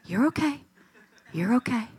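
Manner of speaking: softly reassuring a frightened little one; gentle comfort. Retold or imitated. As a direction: hold their face and soothe them. Parent holding child's face, comforting.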